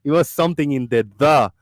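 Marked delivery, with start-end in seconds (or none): 1.2-1.5 "the" punched loud; isolated, forceful "the"; stress on THE. Shown as a demonstration. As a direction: hit "the" hard on its own. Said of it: "that you had to pronounce it".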